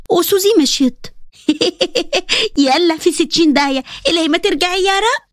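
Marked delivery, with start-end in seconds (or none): 1.5-2.5 gloating laugh. Acted. Hanan gloats as Suzy leaves.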